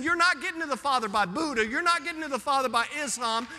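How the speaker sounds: ordinary delivery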